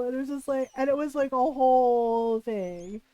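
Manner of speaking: ordinary delivery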